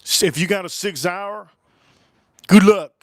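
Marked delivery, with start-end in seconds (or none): 2.5-2.9 loud warning. Acted. Mock warning about the gun.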